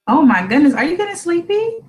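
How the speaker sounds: cooing question climbing high at the end; playful surprise. Pretend. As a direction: ask a small child with exaggerated wonder. Mother coos at yawning child.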